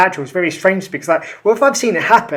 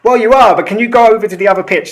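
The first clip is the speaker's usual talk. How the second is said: louder, higher teasing retort; hammed-up referee telling him to leave; mock retort; 4 semitones higher, 6 dB louder, faster than usual. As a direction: send him off to the other pitch, amused. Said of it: "Just like".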